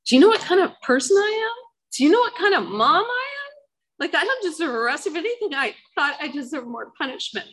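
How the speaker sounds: high-pitched, incredulous outburst; anguished self-reproach. Retold or imitated. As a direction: demand it in a high, strained self-accusation. Acts out her self-loathing outburst.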